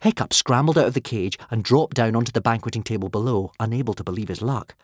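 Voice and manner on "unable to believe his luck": usual speaking voice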